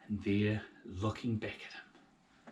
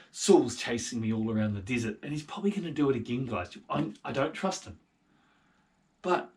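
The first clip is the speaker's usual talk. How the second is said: loud earnest leader; David talking his soldiers down; David explaining; 4 semitones higher, 13 dB louder than usual. Acted. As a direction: reason with the men firmly. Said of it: "And he said".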